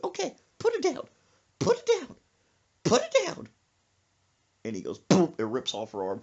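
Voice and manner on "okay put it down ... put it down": high-pitched, insistent commands; mock alarmed mama. Mother pleading for her doll, high mock alarm. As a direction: order it in a high, rising panic.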